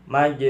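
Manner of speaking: "Maj" with a bounced j; qalqalah sughra. Shown as a demonstration. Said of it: "bacaan qalqalah sughra". (baca "Maj" dengan pantulan kecil pada jim)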